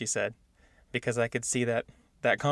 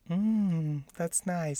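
higher-pitched, drawn-out appreciative mm; smitten approval; 5 semitones higher, much slower than usual. Acted. Guy hearing a Southern belle's drawl, smitten approval. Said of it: "with the little drawl, you're like".

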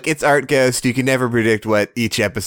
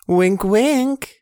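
sly ironic; 11 semitones higher than usual. Acted. Ironic wink aside.

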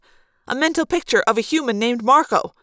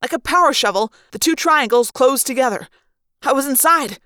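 ordinary delivery

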